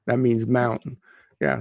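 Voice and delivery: normal talking voice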